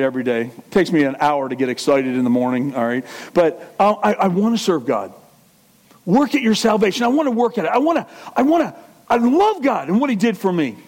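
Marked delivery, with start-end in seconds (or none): none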